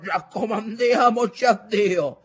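high, slow, loud appeal; calling out. A narrator's reading. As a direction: cry out a prayer over the storm. Grandfather calls out in storm. (invocazione a gran voce)

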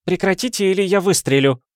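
high-pitched irritable warning; irritated madame. A narrator's reading. Madame threatening to shoot her flatterer, irritated. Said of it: "буркнула мадам".

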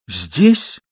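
a small startled gasp of a question. A narrator's reading. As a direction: breathe it out in surprise. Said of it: "охнула собеседница".

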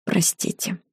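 hushed, nearly voiceless; barely audible apology; soft apology. A narrator's reading. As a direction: add it very quietly, as an afterthought. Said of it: "И я добавила зачем-то".

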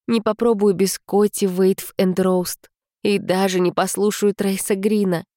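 plain reading voice

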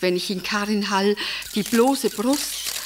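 ordinary talk, nothing marked

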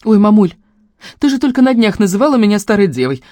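high, loud teasing retort; amused mockery. A narrator's reading. Daughter laughing at mother's contradiction, mocking. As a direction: tease her as if laughing it off. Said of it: "рассмеялась я".

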